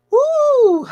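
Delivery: high whooping cheer; excited woo. Real, not acted. Host whooping to her live viewers, excited.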